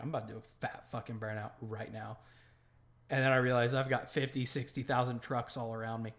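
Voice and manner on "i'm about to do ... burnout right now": muttered threat. Retold or imitated. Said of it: "I'm like".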